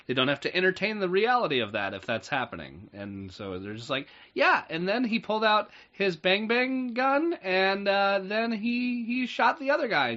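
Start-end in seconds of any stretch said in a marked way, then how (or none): 4.3-10.1 put-on much higher kid voice, excited; little kid storyteller. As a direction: chirp it like an excited child. Imitating a child telling a story.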